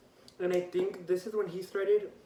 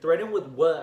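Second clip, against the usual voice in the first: loud, retold comeback question; indignant challenge; 12 dB louder than usual. Teen walking up to the cop, indignant. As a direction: throw it back at him, loudly incredulous.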